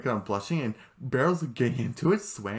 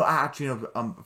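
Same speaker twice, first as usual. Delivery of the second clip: ordinary talk, nothing marked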